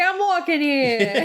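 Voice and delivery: slow, hammed-up New York accent, laughing; mock New York tough guy in a bad New York accent; a laughing, jokey New York accent. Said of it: "bad New York accents".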